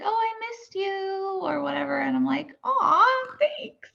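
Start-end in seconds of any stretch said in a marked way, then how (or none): 0.0-1.4 high, slow doting fan; sweet fan voice. Retold or imitated. Fans' message quoted. 2.6-3.9 very high, touched thanks. Retold or imitated. Her reply to fans.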